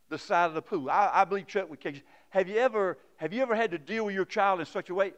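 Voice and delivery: usual speaking voice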